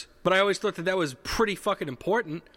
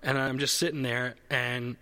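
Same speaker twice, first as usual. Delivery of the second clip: usual speaking voice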